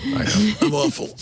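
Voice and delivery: high, squeaky laughing admission. Real, not acted. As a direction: own up through helpless laughter. Laughing at own bad pun.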